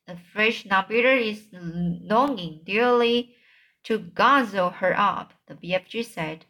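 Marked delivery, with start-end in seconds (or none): none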